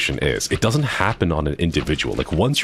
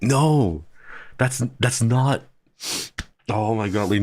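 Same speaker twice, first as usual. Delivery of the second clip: retold exasperation; 7 semitones higher than usual. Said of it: "God, I got so mad".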